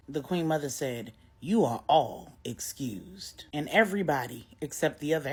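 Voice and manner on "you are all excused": queenly dismissal. Acted. Queen Mother dismissing the room.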